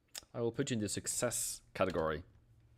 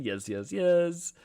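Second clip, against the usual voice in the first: excited yeses; 9 semitones higher, 5 dB louder, much slower than usual. Real, not acted. Excited agreement to chat.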